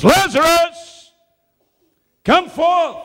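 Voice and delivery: high, loud, slow call "Lazarus, come forth!"; loud cry. Acted. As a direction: call the dead man out of the grave. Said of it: "he cried out with a loud voice".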